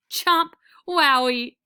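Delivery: loud, gleeful chomp and cheer; overjoyed at the treat; excited. A narrator's reading. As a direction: gobble it up with delight. Dog gobbles treat.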